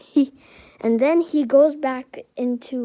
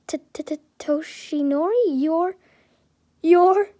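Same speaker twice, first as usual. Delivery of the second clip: stuttered start, rising louder and higher; shocked stammer; 5 semitones higher, 3 dB louder than usual. A narrator's reading. Shocked Inko recognizes Toshinori, stunned stammer. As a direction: stumble over it, voice climbing in disbelief.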